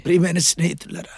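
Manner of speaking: low, soft appeal to friends; gentle address. Real, not acted. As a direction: drop right down after the shouting. Tender address after the climax. (మృదువుగా, తగ్గు స్వరంతో)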